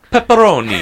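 hammed-up Italian flourish on the topping; mock Italian accent. Diner ordering pizza toppings, mock Italian accent. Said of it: "start going into accents".

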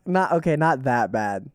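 usual speaking voice

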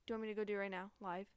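usual speaking voice

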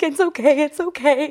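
high-pitched consoling coo; retold reassurance. Reassuring a woman crying in pigeon, retold sympathy.

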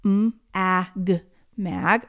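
"Mm, ag" stretched out sound by sound, then blended into "mag"; segmenting sounds. Shown as a demonstration. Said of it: "what are the sounds".

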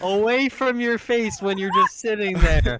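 shouting. Real, not acted. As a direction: shout it across the room. Yelling instruction at co-host.

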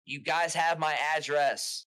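mock indignant. Retelling what he said to the boss, a bit loud and indignant. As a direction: spell it out like it's obvious.

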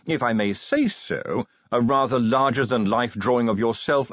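formal butler. Acted. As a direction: read the news out like a proper manservant. Voices butler in story.

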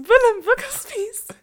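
high, loud playful echo; हँसी भरी चहकती आवाज़. Pretend. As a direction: blurt the silly name out loud and high. Co-host echoes the silly name, laughing.

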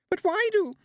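very high, loud bleat; frightened protest. A narrator's reading. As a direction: protest in a panic, cut off. Terrified Anagramma starts to protest.

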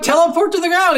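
exasperated urging. Retold or imitated. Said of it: "I'm like".

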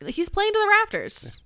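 ordinary talk

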